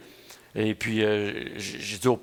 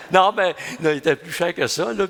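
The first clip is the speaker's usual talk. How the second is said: laughing; 5 semitones higher, 4 dB louder than usual. Real, not acted. Laughing at old cigarette price.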